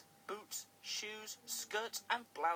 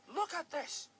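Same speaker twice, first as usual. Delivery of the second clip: shout; 10 semitones higher, 5 dB louder than usual. Acted. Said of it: "he shouted".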